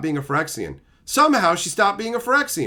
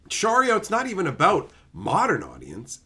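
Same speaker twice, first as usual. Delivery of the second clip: ordinary talk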